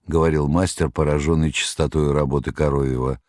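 plain reading voice

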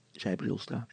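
ordinary delivery, nothing marked

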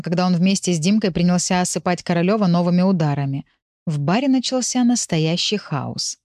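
ordinary delivery, nothing marked

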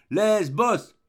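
cheering yell from the crowd on the riverbank; crowd shout. Acted. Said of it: "toutes les voix ... criaient ensemble".

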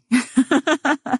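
a bright high burst of laughter; hearty laugh. Acted. Two friends leaving the room, laughing together. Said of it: "يتضاحكان".

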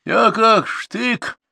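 very high, drawn-out cry; blurted outburst. A narrator's reading. Tipsy godfather bursts from the hut, crying out high. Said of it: "вырвался, наконец, из шалаша".

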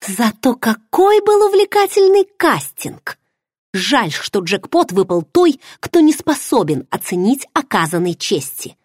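sarcastic. Acted. Heroine sneers at the man about the casting. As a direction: spit the sarcasm, mock-cheerful. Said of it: "съязвила я".

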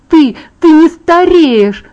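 loud, high, drawn-out furious accusation; growled through teeth. A narrator's reading. Girl furious that her friend never ages, growling. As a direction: force each word out through gritted teeth. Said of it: "сквозь зубы прорычала я".

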